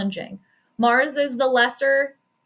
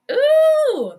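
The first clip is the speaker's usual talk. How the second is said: loud, high "ooh"; long high-pitched reaction whoop; impressed ooh; 7 dB louder than usual. Real, not acted. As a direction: react loudly to a big play. Reacts to batted interception.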